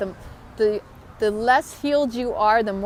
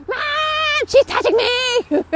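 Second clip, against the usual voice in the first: high, loud whiny shout to mom; whiny tattling little sibling; 8 dB louder, much slower than usual. Retold or imitated. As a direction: tattle like a wronged kid.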